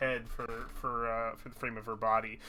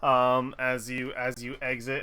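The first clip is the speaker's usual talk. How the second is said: normal talking voice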